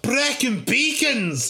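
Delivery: high, slow delighted cry; excited. Acted. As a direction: gasp it out with delight. Excited echo of holiday place.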